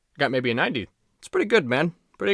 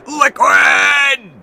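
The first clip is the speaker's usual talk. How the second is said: yelled hammy game shout; shouted callout; 11 semitones higher, 9 dB louder than usual. Retold or imitated. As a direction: bellow the name like the game. Imitating the Liquid callout.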